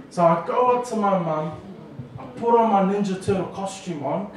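ordinary talk, nothing marked

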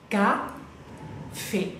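"café" split in two, pause before the open "fé"; teacher's broken-down "café" with an open é; syllable-by-syllable. Shown as a demonstration. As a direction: sound out "café" slowly as ca, then fé. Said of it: "Ese acento es bien abierto".

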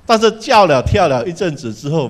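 ordinary talk

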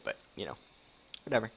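ordinary talk, nothing marked

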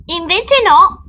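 put-on high, loud child voice; pretending to think. A narrator's reading. Child feigning thought to trick the witch, high-pitched contradiction. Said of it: "facendo finta di pensare".

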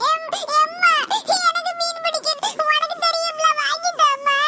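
put-on tearful child begging mother; crying plea. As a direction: sob out a whiny request. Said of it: "எதுக்கு அழுதுட்டு இருக்க". (அழுதுகொண்டே கெஞ்சல்)

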